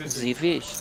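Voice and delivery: put-on Z Fish in a soft French accent; lightly Frenchified Z Fish. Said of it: "the French accent".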